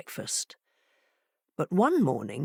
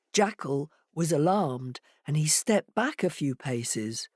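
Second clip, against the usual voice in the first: usual speaking voice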